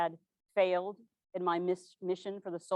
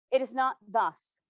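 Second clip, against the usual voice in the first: usual speaking voice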